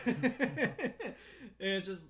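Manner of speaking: laughing through words; giggling while speaking. Real, not acted. Laughing while describing a scene.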